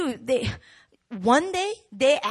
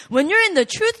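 usual speaking voice

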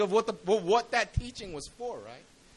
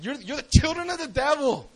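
higher, forceful accusation; acted condemning outburst; retold denunciation; 6 semitones higher, 4 dB louder than usual. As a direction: denounce them with preacher's force. Jesus denouncing the Pharisees.

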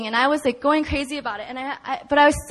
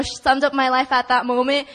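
ordinary delivery

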